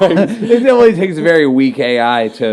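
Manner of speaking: loud, high, laughing through the words; giggling mockery. Real, not acted. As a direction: crack up while saying it. Laughing at Biden joke.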